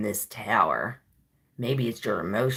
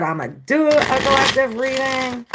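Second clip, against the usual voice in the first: fast, much higher and louder; loud, high, fast, gleeful; excited self-quote; 12 semitones higher, 8 dB louder than usual. Retold or imitated. Quoting herself, exuberant.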